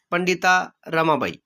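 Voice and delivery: usual speaking voice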